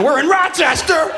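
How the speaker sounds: high, slow crowd shout; loud, drawn-out hometown cheer. Real, not acted. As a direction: call out to the audience.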